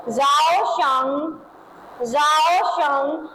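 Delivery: जाओ शंग stretched very slowly, जाओ stressed with a rise; slow pronunciation model. Shown as a demonstration. Teacher models जाओ शंग slowly so students can repeat. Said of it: "क्या पढ़ेंगे?".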